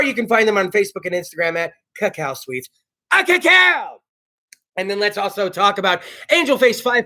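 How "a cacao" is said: high, loud sponsor cheer; excited shout. Real, not acted. Host shouting the bakery's name to the audience, loud cheer. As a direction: belt it out like a jingle.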